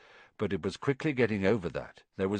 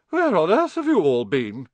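loud, high-pitched indignant question; shocked portrait lady, raised voice; scandalised demand; 7 semitones higher, 7 dB louder than usual. A narrator's reading. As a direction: exclaim it like an affronted matron. Fat Lady demands an explanation.

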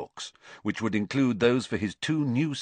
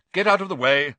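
loud, raised, impatient order; 7 semitones higher, 7 dB louder, faster than usual. A narrator's reading. Harried manager clears Harry from his path, impatient. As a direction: order someone aside impatiently. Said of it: "said the manager impatiently".

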